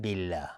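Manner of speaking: بالله with a light, thin L after kasra; thin Allah. Shown as a demonstration. Teacher drawing attention to the kasra, بالله pronounced thin. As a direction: say بالله with an open front vowel, not rounded.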